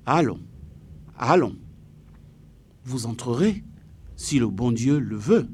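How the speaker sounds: "Allons, allons" each dropped in pitch, with a pause after each; firm, deliberate syllable-by-syllable delivery; every syllable stressed. A narrator's reading. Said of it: "en appuyant sur chaque syllabe".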